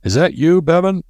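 high, hearty call of greeting; roared greeting. A narrator's reading. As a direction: call out to the arrival, pitch way up. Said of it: "roared a greeting as he came".

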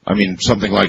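ordinary talk

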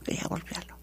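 breathy whispered confession. Real, not acted. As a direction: drop to a hushed whisper, as if ashamed to admit it. Confessing the urge to strike.

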